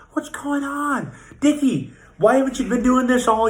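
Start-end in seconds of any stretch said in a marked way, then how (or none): 0.1-1.1 mock puzzled, drawn out. Repeated puzzled question.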